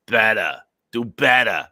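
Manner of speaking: "Better" as a loud, broad "badda", mocking a heavy New York accent; mocking regional accent. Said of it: "That's how bad your accent is?".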